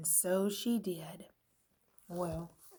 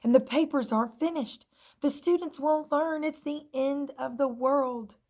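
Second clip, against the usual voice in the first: higher, fast whispered panic; panicked pencil; 7 semitones higher, 7 dB louder than usual. A narrator's reading. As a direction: fret breathlessly. Pencil despairs.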